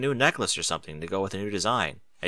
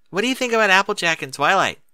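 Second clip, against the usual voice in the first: louder, higher, clearly spelled out; speaking up; 9 semitones higher, 5 dB louder than usual. Acted. Cheerilee repeats the question to Granny, raised voice. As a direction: raise it for a hard-of-hearing elder.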